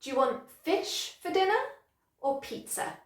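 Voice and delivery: light "Do you want", strong "fish", "dinner" and "pizza"; modelled sentence stress. Shown as a demonstration. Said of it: "try that after me".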